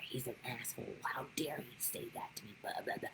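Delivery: a hushed mock of petty indignation; imitating resentful thoughts in a whisper; whispered mock resentment. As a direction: whisper it like a grudge you are nursing. Mocks resentful inner voice.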